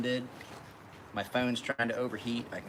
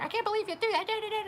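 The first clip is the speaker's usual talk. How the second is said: high squeaky mocking of a nagging woman; high squeaky nagging impression; mocking nag voice; faster than usual. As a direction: parody her whining, pitched up high.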